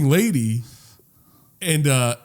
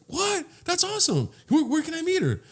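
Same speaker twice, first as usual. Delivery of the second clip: much higher, excited mom voice; high-pitched mock delight from a mom; 5 dB quieter than usual. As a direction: gush like a thrilled old mother. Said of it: "She's like".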